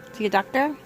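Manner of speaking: plain reading voice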